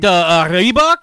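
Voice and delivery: slow, loud mock Russian accent; halting Russian accent, offering a random English word; thick Russian accent. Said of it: "like, a really thick accent".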